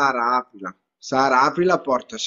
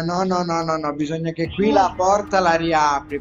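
ordinary talk

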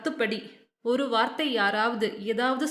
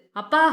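"அப்பா" pressed out higher and louder; firmly stressed; 4 semitones higher, 6 dB louder than usual. A narrator's reading. Daughter cutting in on her father, "அப்பா" firmly stressed, rising. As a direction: hit "அப்பா" hard, lifting it at the end. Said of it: "Shobana பயங்கரமாய் அழுத்தினாள்".

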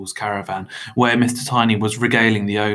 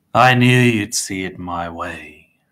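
slow, louder self-satisfied line; beaming; 8 dB louder, slower than usual. A narrator's reading. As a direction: gloat pleasantly, drawing it out. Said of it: "Mister Tiny beamed".

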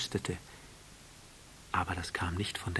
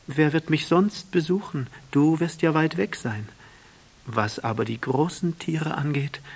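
higher and louder proud flower; flower's voice; 7 semitones higher, 5 dB louder than usual. A narrator's reading. The flower sending the prince away, higher and insistent. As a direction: brave and insistent, hiding her hurt.

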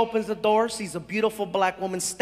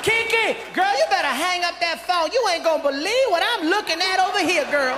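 put-on higher, animated girlfriend voice; high-pitched gossip to a girlfriend; sassy woman on the phone; 7 semitones higher than usual. Woman phones her friend about what she sees, sassy.

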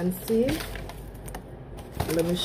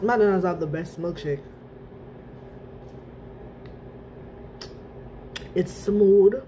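sipping between words; speaking around a straw. Real, not acted. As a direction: lips on drinking straw. Seen 2: holds shake while talking; straw reaches lips from 7.4 on.

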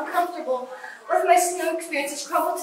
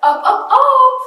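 high and loud, insistent; urgent call; 8 semitones higher, 10 dB louder, slower than usual. Real, not acted. Urging her friend to look at the sky, insistent. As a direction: push her to look up now.

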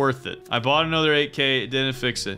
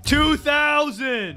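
high, drawn-out cry; high anguished stretch of the words; wailed admission; 8 semitones higher, much slower than usual. Real, not acted. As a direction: wail it slowly in dismay. Admitting money spent, upset.

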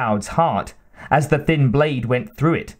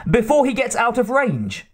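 high, agitated urging; crying out; 10 semitones higher than usual. A narrator's reading. Hotchkiss dancing around his master, crying out. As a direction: hurry the master up with pitch held high. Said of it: "cried Hotchkiss".

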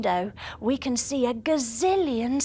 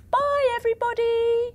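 cheerful goodbye; 9 semitones higher than usual. Acted. Waving goodbye to viewers.

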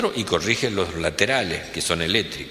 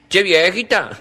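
higher, louder squawky parrot; 10 semitones higher, 5 dB louder than usual. Acted. Parrot teasing the old woman, higher and louder. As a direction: play the cheeky parrot. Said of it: "Y le dijo el loro".

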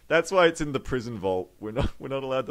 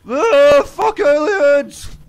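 very loud, high, drawn-out yell; crazed high-pitched battle cry; screaming man; 14 semitones higher, 13 dB louder, much slower than usual. Acted. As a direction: scream it at the top of your voice. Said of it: "the man is screaming something".

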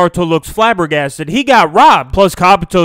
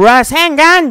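mock anime scream; 8 semitones higher than usual. Naruto calling his attack while fighting, high mock scream. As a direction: call it out high like an attack name. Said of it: "Naruto's screaming".

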